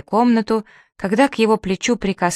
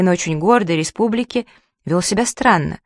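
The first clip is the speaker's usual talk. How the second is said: usual speaking voice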